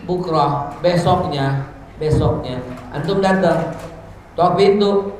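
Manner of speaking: normal talking voice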